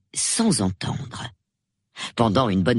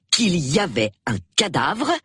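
plain reading voice

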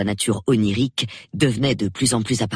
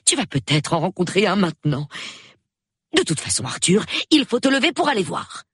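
high-pitched, pressing wife; urgent insistence; 7 semitones higher than usual. Acted. Wife urges husband to go and look, insistent. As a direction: urge him up in a high voice.

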